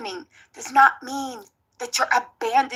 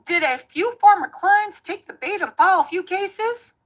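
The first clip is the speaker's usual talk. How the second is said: put-on Tony Busby accent; 2 semitones higher than usual. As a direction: play a smug Texas lawyer with a light Southern accent. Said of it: "my Tony Busby accent is impeccable".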